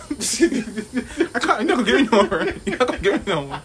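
high, loud laughing protest. Real, not acted. Laughing at his friend, can't stop laughing.

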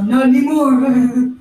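put-on British accent. Host briefly slips into British accent, teasing the guest. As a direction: drop into a British accent for a beat, then out. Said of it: "you had a British accent for a second".

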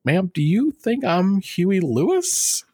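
high, drawn-out disbelieving question; mock incredulous. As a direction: imitate someone baffled by the question, pitch climbing. Retold incredulous reply to fan.

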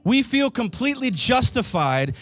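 loud, high preaching to the church. Real, not acted. Pastor preaching to his congregation at full force. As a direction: preach it out, rising with fervour.

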